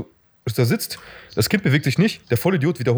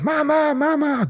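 high child's "Mama, Mama", imitated rather than screamed; imitating a screaming girl; much slower than usual. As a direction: imitate the girl calling for her mother. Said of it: "und schreit". (nachgeahmtes Kind, hoch gerufen)

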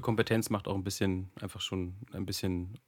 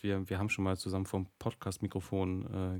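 ordinary talk

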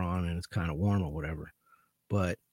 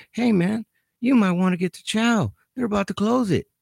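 higher, louder sweetly innocent tip; mock friendly; 11 semitones higher, 8 dB louder than usual. Officer teasing the late inmate, mock friendly warning. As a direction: fake helpfulness, laying it on thick.

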